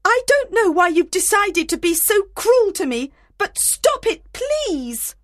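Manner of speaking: high, hurt and indignant plea; upset shouting. A narrator's reading. Helena begs both men to stop, shouting upset. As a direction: protest their cruelty, very offended. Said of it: "Helena shouted at Demetrius and Lysander".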